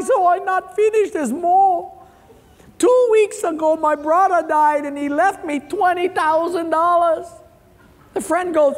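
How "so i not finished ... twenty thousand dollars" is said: very high fake-tearful whine; mock crying. Crying man explains more to his friend, mock wailing. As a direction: wail it out like a comic crybaby. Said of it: "this guy who was crying".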